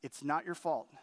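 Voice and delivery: a hint of the wife's gentle forgiveness; tender forgiveness. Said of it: "She said to me".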